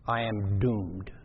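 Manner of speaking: low, slow, soft sense of doom; devastated. Acted. Preacher voicing the devastated prophet, low and doomed. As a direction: let it sink, heavy and hopeless. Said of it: "He is devastated".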